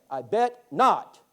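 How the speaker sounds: slow, knowing deadpan; dry slow reply. Retold or imitated.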